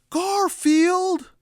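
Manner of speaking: high, loud scolding yell; exasperated owner yell; scolding shout. Acted. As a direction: call the cat out sharply. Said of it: "says John".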